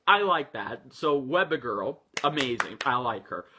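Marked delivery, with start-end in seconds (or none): none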